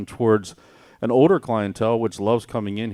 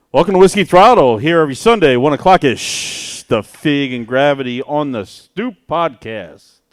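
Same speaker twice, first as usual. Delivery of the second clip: hype announcer; 4 semitones higher, 10 dB louder than usual. Acted. Hosts reopen the show, announcing.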